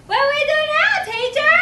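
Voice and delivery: put-on high, loud child question; eager little kids. Lined-up kids ask teacher what's next, high loud child voice. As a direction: pipe up like a preschooler. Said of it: "they're like".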